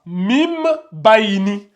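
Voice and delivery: "mim bayni" very slow, m held before the b; qalb pronunciation. Shown as a demonstration. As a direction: linger on the m of "mim", then "bayni". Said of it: "Donc, se lit comme ça". (« mim bayni » lentement, m tenu avant le b)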